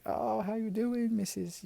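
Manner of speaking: high, slow sugary hello; polite greeting. Retold or imitated. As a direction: greet the old teacher sweetly. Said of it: "Paul's like".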